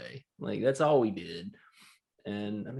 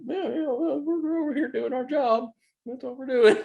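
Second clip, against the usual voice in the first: much higher, put-on innocent voice; faking innocence. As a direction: play dumb, all innocence.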